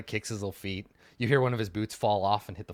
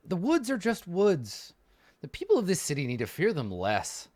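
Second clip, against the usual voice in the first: dismissive noble; 7 semitones higher than usual. Acted. Reza voiced, dismissive of fears.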